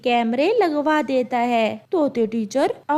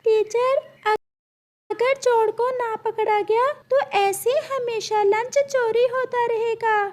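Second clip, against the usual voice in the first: high, bright-pitched teacher bird voice; a high squawky parrot teacher calling on a student; 10 semitones higher than usual. Acted.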